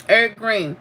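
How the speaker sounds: normal talking voice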